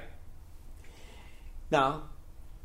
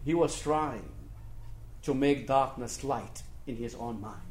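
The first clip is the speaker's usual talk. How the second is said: normal talking voice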